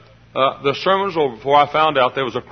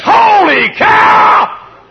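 excited high, drawn-out holler; Harry Carey; 9 dB louder, much slower than usual. Acted. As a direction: shout it slow and huge. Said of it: "Harry Carey, he says".

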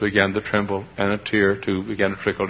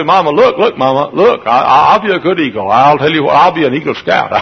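lightly voiced eaglet, louder and urgent; pleading child; 5 semitones higher, 9 dB louder than usual. Eaglet begs Mama to keep him, mildly pleading. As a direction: bargain eagerly with Mama.

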